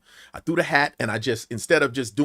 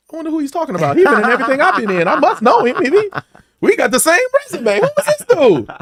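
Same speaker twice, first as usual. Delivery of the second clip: baffled wondering; 12 semitones higher, 7 dB louder, faster than usual. Retold or imitated. Retells his baffled inner thoughts.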